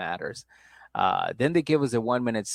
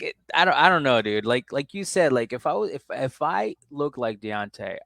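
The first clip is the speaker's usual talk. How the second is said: normal talking voice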